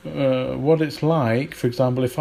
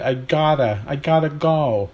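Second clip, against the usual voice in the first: American contraction; 3 dB louder than usual. Shown as a demonstration. Teacher demonstrating contractions, put-on American "gotta". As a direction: run "gotta" together in an American accent. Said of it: "we hear contractions like".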